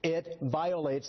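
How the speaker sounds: It violates spelled out slowly, word by word; condescending slow delivery; slow, deliberate. Shown as a demonstration. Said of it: "in words of one syllable".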